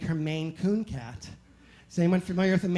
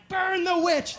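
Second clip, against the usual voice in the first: higher mock mob cry of burn the witch; 10 semitones higher, 4 dB louder, slower than usual. Theater crowd turning on the flosser, mock outrage. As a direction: play the outraged crowd, pitched up.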